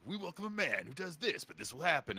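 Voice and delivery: mock movie-trailer narrator, swooping pitch; sarcastic trailer voice. Explaining honest trailers to friends, sarcastic narrator voice. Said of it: "sarcastically describing the movie".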